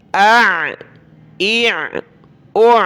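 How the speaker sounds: careful ain demo on آ، ای، او; correct ain sound. Shown as a demonstration. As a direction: end each of آ، ای، او on a throat-squeezed ain. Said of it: "آپ نے عین تو پڑھا نہیں، حمزہ پڑھ لیا".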